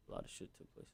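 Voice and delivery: barely audible whisper. Real, not acted. Rapper whispering about his painful breakup to the interviewer. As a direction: let the words fade into a whisper.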